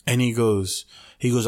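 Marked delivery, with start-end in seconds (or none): none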